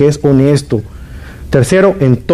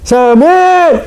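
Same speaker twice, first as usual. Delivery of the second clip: shouting a name; 11 semitones higher, much slower than usual. Retold or imitated. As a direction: yell the name out the window. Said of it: "empieza a gritar".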